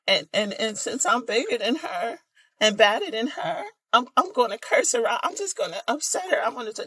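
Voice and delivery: high, wavering mock girly voice; imitating a high girly emotional man; girly emotional guy. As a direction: camp it up like an overwrought girly guy. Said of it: "this is what his ears interpret".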